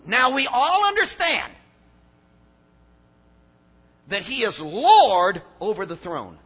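loud preaching, big falling emphasis on key words; full-force preaching. Acted. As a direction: preach it to the back row, hammering each point. Preacher driving home his point.

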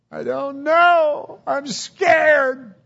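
frightened cry. Acted. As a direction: cry out in fear for her husband. Wife cries to father-in-law, frightened.

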